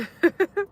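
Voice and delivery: a short, very high laugh; quick delighted giggle; real laugh. Real, not acted. Laughing at a viewer who keeps sending blue hearts.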